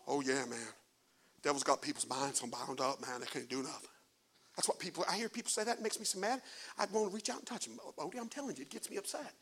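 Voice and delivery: dropped way down, talking privately to Odie; low aside. Real, not acted. Preacher turning aside to tell Odie quietly. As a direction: murmur it low and fast to the man beside you.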